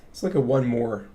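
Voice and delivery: ordinary talk, nothing marked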